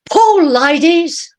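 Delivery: high, loud, slow scream to pull; screamed order. Acted. Sailor screaming at the women as the lights fail, loud and high. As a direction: yell it out in panic. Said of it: "People begin to scream".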